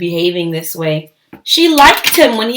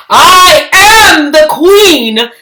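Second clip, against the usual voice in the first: proud declaration; 7 semitones higher, 9 dB louder, slower than usual. Acted. Lily declares herself queen.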